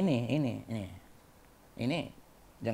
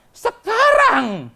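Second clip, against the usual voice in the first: very high, loud, long-stretched word; emphatic proclamation; 12 dB louder, much slower than usual. Real, not acted. Preacher naming the age of suffering, emphatic proclamation. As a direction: belt out the word and hold it.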